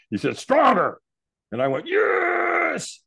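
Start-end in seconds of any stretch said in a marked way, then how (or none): 0.4-1.0 very high, forceful yell; screamed demand. Retold or imitated. Trainer screaming at the hesitant firewalker, screamed demand. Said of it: "he screamed at me again". 1.9-3.0 very high, long screamed yes; all-out scream. Retold or imitated. Firewalker answering the trainer's final demand, all-out scream. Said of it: "as soon as I screamed like that".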